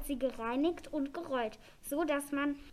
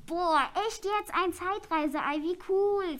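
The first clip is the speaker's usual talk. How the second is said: higher, louder thrilled exclamation; amazed; 4 semitones higher, 5 dB louder than usual. Acted. Elf finally sees the time-travel egg, amazed. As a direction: gush with awe and delight.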